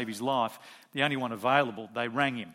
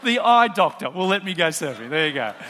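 louder, laughing through the words; laughing joke; 3 semitones higher, 11 dB louder than usual. Real, not acted. Pastor joking with congregation about surfing, laughing.

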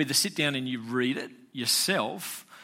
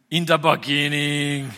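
slow, louder Austrian action-hero voice; Arnie voice; 6 dB louder, slower than usual. Retold or imitated. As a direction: boom it out like a muscle-bound movie star. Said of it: "Everyone can do Arnie".

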